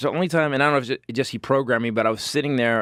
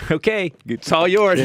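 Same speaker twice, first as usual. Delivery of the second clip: high, slow, cheery giving-up voice; sing-song mock surrender; mock cheerful husband; 6 semitones higher, 4 dB louder, slower than usual. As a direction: hand it over with fake glee. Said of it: "you go".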